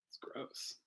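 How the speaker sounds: a hushed whisper of disgust. Real, not acted. Pastor reacting to a gross chat comment, whispered. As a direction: breathe it out under your breath.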